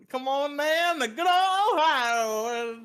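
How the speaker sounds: high, slow hammed-up Southern drawl; mock Southern accent. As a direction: play a cheesy Southern welcome. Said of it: "doing a southern accent for?".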